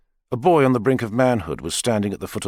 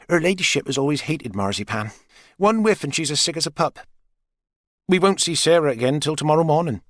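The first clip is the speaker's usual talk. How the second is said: higher, lightly voiced landlady, chatty; a gossiping landlady's aside; light gossipy wife voice; Maggie's voice; 6 semitones higher than usual. A narrator's reading.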